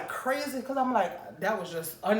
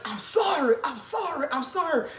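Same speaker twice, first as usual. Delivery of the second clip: crying apology; 7 semitones higher than usual. Retold or imitated. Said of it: "This man started crying".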